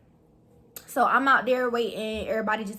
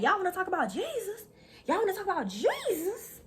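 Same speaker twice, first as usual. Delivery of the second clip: teasing taunt; 9 semitones higher, 5 dB quieter than usual. Retold or imitated. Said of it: "I really just, like, going in".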